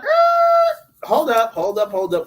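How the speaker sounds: a sudden high yelp, then a loud "hold up"; mock shout. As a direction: jump in and stop them cold. [name] jumps in to interrupt.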